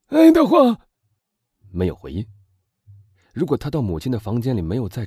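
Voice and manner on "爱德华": loud, high cry; desperate call. A narrator's reading. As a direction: call out for your son in panic. Father calls for missing son. (绝望地高声呼唤)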